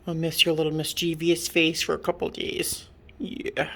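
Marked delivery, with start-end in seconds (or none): 3.2-3.7 soft whisper to the cat. Real, not acted. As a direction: hush it like a secret. Whispering to pet.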